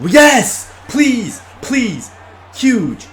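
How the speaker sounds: ordinary talk, nothing marked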